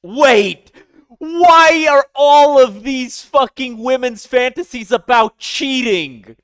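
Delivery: loud, high disbelieving yell; loud incredulous rant; outraged shout. Real, not acted. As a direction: shout it in mock outrage at the book. Outraged reaction to cheating plot.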